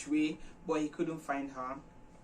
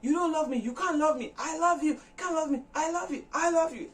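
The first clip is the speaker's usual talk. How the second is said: high, loud shouted back-and-forth; yelling at each other; 12 semitones higher, 4 dB louder than usual. Retold or imitated. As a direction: hurl the lines at each other. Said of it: "They were now yelling at each other".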